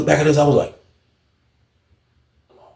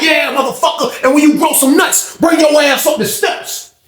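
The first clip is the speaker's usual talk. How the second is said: high-pitched shouted dare; hammed-up heated comeback; acted taunting challenge; 12 semitones higher than usual. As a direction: goad him on, voice way up.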